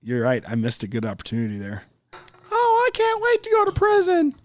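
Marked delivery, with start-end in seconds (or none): 2.5-4.3 much higher, put-on voice of a keen kid; eager kid voice. Made-up kid in class asking about prison, high eager voice. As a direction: play a kid dying to get in.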